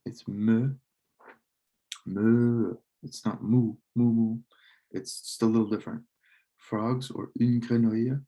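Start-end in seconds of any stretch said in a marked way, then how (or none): 0.1-2.8 mooing "me, me" like a cow, vowel drawn out; French cow sound. Shown as a demonstration. Teacher to her class, demoing the French cow sound, a drawn-out cow moo on "me". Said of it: "they have me instead of moo". 3.0-4.4 "moo, moo" mooed like a cow, a little louder; English cow moo. Shown as a demonstration. Teacher to her class, contrasting the English cow sound, a louder "moo" said like a cow. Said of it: "instead of moo".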